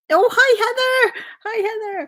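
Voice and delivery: high, delighted squeal of recognition; light up as a friend arrives; excited hello. Real, not acted. Spotting a viewer in chat.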